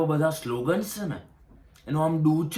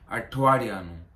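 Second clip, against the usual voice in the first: ordinary talk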